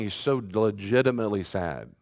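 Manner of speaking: normal talking voice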